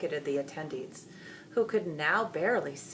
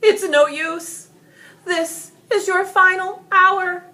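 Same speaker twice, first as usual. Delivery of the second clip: much higher, loud, slow heroic declaration; stern denouncement; 13 dB louder, slower than usual. A narrator's reading. Knight denounces the dragon loudly and slowly. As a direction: condemn the beast with certainty. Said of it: "George denounced".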